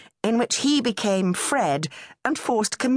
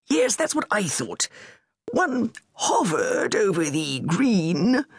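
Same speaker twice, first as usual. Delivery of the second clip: lightly raised, pompous royal voice; a fussy prince pleased with his purchase; Charles; 2 semitones higher than usual. A narrator's reading. Charles to Camilla about his bowl, lightly pompous.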